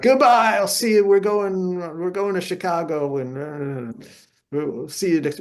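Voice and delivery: a star's loud, cheery farewell to the room; breezy farewell. Retold or imitated. Mickey Mantle leaving the club, cheery loud goodbye. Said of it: "Mickey Mantle's like".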